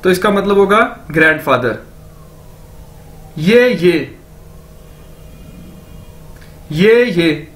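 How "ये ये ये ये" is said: "ये, ये" as two joined syllables; careful language-teacher "ये, ये"; demonstrated pronunciation. Shown as a demonstration. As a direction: drill "ये, ये" with long pauses between takes. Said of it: "दो बार ये को बोलेंगे".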